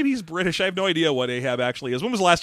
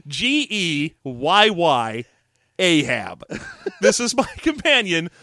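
ordinary talk, nothing marked

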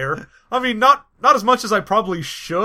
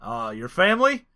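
halting presidential impression; measured politician, long pauses and stretched words; Barack Obama. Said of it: "A M A of Barack Obama".

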